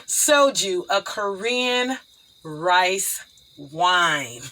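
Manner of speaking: slow, careful enunciation word by word; measured slow delivery, spacing out each word; deliberately slow. Real, not acted. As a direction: say it slowly to avoid tripping over it. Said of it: "I gotta say that slow".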